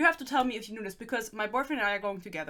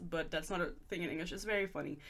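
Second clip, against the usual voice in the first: normal talking voice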